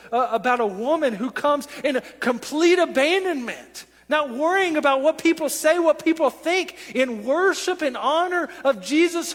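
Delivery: loud, high-pitched sermon climax; impassioned preaching. Real, not acted. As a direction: preach it at full force, urgently. Preaches at full force.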